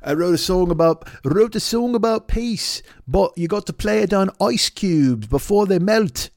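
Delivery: high, slow put-on musician voice. Comedian mocking a Beatle's son explaining his song, high drawn-out voice. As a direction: draw the words out high, like a precious experimental artist. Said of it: "he's like".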